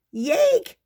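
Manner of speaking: very high, loud yip. Acted. Pip yipping as everything falls, high and loud. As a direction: yelp like a startled pup. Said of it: "Pip yipped".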